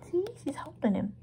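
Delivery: ordinary delivery, nothing marked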